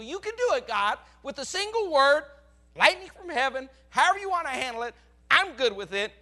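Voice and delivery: loud, high pleading cry to God; loud imploring prayer. Retold or imitated. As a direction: beg God to act, full voice. Preacher voices his plea to God.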